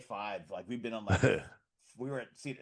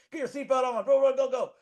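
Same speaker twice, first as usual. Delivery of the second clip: much higher and louder, rushing; hurried excitement; 13 semitones higher, 13 dB louder than usual. Retold or imitated. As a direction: urge them on fast. Ride staff rushing.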